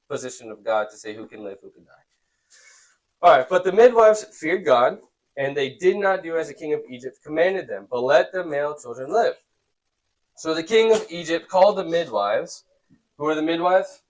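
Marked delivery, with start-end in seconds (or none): 3.5-12.6 raised, clear read-aloud voice; scripture reading. A narrator's reading. Teacher reads how midwives feared God, clear reading. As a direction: read the story out to the room.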